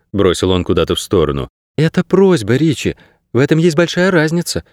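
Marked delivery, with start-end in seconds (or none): 1.8-4.6 put-on high, soothing young girl voice; comforting girl's voice. A narrator's reading. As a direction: console him as a young girl. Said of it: "утешила его Кейса".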